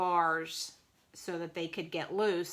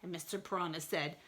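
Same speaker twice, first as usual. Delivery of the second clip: plain reading voice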